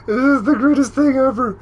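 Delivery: thrilled at the sight; delighted. Real, not acted. Delighted by photo.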